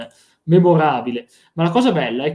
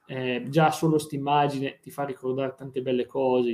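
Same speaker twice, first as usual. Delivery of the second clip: ordinary talk, nothing marked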